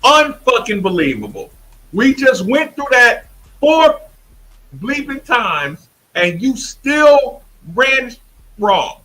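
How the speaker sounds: hammed-up coach at practice; imitating an angry coach. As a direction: play the furious coach chewing out a player. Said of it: "You would see Kenny Payne saying".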